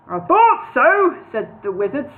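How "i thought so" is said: knowing. Acted. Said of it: "smiling knowingly".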